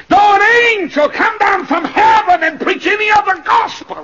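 high, full-force pulpit shout; loud preacher's roar; thundered scripture. Acted. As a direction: declaim the verse at the top of the voice.

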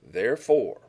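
loud, proclaimed Therefore with a falling finish; booming therefore. Real, not acted. Pastor opening the verse to his flock, loud proclamation. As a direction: announce "Therefore" like a preacher. Reading verse aloud emphatically.

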